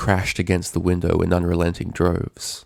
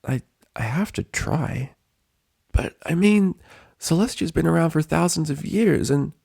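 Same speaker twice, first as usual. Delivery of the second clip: higher, stammering then hurried; anxious Spike; 10 semitones higher than usual. A narrator's reading. Spike arguing with Twilight about Rainbow. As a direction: talk her out of it nervously.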